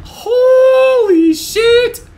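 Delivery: long drawn-out shout of shock; stunned shout. Real, not acted. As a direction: let it stretch out in disbelief. Shocked at the special reward.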